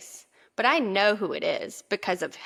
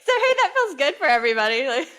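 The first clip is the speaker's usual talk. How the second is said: laughing; 8 semitones higher, 4 dB louder than usual. Real, not acted. Laughing through the joke.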